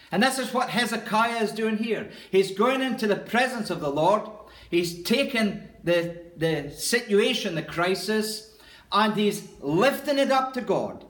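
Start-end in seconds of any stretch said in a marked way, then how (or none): none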